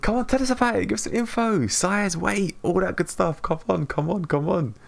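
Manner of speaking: high-pitched, eager prodding; excited urging. Real, not acted. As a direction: press a friend excitedly for the news. Urging friend for baby news.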